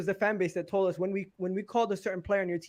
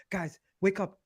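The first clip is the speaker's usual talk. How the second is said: softly re-enacted, as if just waking; 7 dB quieter than usual. Re-enacting his own video before the Tottenham loss, as if waking. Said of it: "like someone's waking up".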